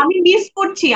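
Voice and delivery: high, stressed cry of missing them; emphatic longing. Real, not acted. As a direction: say how badly you miss everyone. Actress emphatically misses colleagues. (উচ্চস্বরে আবেগভরা আকুতি)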